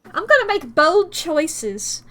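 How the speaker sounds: slow, loud over-the-top Cockney. Acted. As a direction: ham up a Cockney accent. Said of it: "I'm going to be Cockney".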